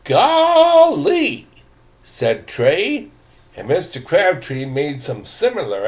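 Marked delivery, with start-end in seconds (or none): 0.0-1.5 put-on high, loud boyish gasp of wonder; high astonished boy voice; amazed exclamation. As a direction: blurt it out in awe.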